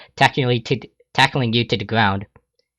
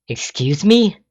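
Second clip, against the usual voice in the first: higher, indignant question; appalled; 8 semitones higher, slower than usual. Acted. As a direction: react in offended disbelief.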